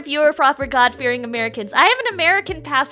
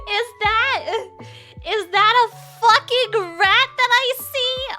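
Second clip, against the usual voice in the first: dramatic sing-song; 8 semitones higher than usual. Pretend. Mock-dramatic rat discovery.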